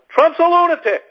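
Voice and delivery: high-pitched mock outrage; squealed, exaggerated alarm; outraged critic. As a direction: imitate a hysterical critic. Mimics outraged anti-Trumpers.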